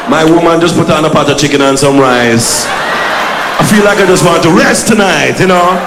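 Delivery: lightly put-on Jamaican accent, slow and laid-back; relaxed mock Jamaican drawl; Jamaican patois. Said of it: "He'd say".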